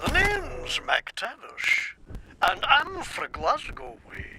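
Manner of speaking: soft, slow rehearsal of a Scottish accent; quiet Scottish accent test run; practising a Scottish accent. Shown as a demonstration. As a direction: try out a Glasgow accent under your breath. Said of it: "that's not it".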